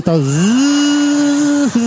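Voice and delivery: long high droning buzz; buzzing noise. Retold or imitated. Comedian imitating the vibrator buzz on the cat. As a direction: hold a steady mechanical buzz.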